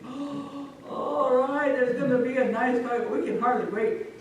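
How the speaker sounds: high, eager exclamation; excited anticipation. Acted. Crowd eagerly awaiting a fight.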